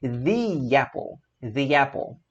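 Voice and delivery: "The apple" slow, thee with Y glide; thee-yapple slowly. Shown as a demonstration. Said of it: "Vamos ler comigo".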